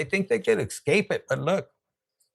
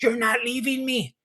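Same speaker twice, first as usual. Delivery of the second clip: clingy partner; 6 semitones higher than usual. Retold or imitated. Voicing the clingy new partner.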